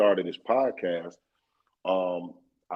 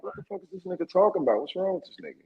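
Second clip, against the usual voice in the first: baffled inner reaction; 8 semitones higher, faster than usual. Retold or imitated. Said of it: "And I'm like".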